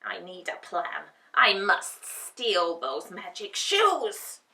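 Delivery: loud cackling witch, slowing at the end; scheming witch. A narrator's reading. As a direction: plot it like a greedy witch. Witch plotting aloud.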